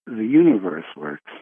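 usual speaking voice

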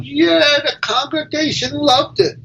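a rabbi boasting of his sermon; proud rabbi. Acted.

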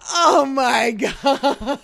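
slow, stretched oh my God broken by a laugh; laughing disbelief. Real, not acted. Telling a friend about the security call, laughing disbelief. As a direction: laugh through the words in shock.

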